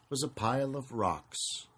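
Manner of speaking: ordinary delivery, nothing marked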